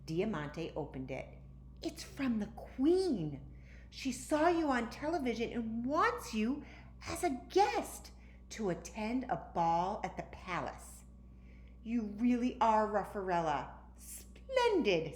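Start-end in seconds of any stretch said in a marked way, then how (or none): 1.8-11.0 excited reading. A narrator's reading. Girl thrilled reads the Queen's invitation to her dog. 11.8-15.2 higher, louder, proud and pleased; delighted Ruffarella. A narrator's reading. Dog-girl delighted about invitation to the palace. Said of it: "said Ruffarella".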